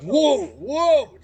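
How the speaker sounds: high startled crowd gasp; surprised crowd. Acted. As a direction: squeal it like a shocked onlooker. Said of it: "some people just kind of like".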